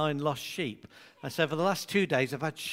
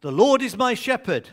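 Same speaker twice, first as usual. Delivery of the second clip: loud, raised reading voice; responsive reading; 4 semitones higher, 8 dB louder than usual. Acted. Preacher leading the congregation's responsive reading, loud. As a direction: lead the congregation firmly.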